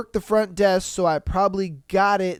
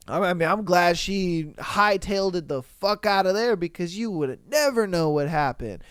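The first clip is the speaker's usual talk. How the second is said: ordinary talk, nothing marked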